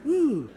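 high drawn-out ooh; a startled old lion's ooh; mock lion groan. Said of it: "He's kinda".

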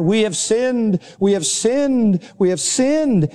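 restrained retelling of a crowd crying out; mildly acted cry of repentance; penitent outcry. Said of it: "they began to cry out".

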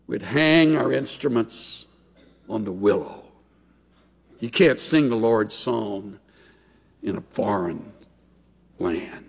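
low, soft lament with long pauses; sorrowful. Acted. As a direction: let it sink away, fading on the last words. Captives' sorrowful answer to the taunts.

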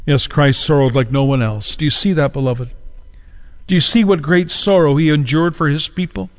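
ordinary talk

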